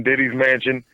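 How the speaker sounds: ordinary delivery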